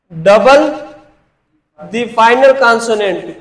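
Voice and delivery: slow rule repeat; slow dictation. Shown as a demonstration. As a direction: double the final consonant split and stretched. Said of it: "किसको?".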